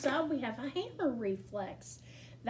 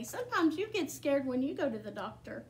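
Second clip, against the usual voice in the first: plain reading voice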